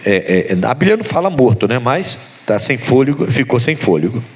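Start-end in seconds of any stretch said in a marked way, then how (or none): none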